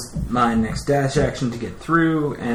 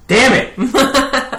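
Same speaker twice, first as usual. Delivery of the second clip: laughing frustration; 14 semitones higher, 9 dB louder than usual. Real, not acted. Bad roll, frustrated outburst.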